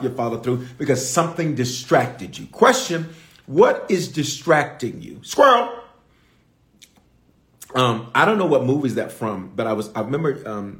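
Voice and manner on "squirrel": sudden high loud yelp of squirrel; Up dog squirrel cry. Retold or imitated. Quoting the dog from Up, sudden yelp. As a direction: blurt it like the distracted dog. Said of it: "from the movie Up".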